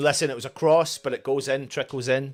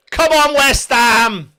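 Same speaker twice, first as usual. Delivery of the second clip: high, loud, drawn-out fan cheer; rallying shout; 7 semitones higher, 7 dB louder, slower than usual. Real, not acted. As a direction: roar the team on slowly. Cheering the team, louder repeat.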